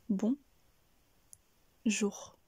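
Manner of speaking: BON, then a long pause, then JOUR; split syllables. Shown as a demonstration. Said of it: "Coupez le mot en plusieurs morceaux".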